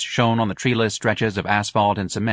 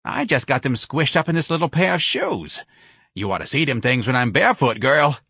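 higher-pitched, lively comic complainer; brash Santa character; 5 semitones higher than usual.

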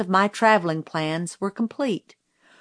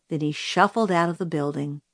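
ordinary delivery, nothing marked

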